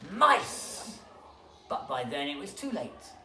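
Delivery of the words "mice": realizing shout. Acted. All realize.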